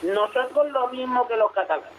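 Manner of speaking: mock regional voice. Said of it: "saltarán los bajos y dirán".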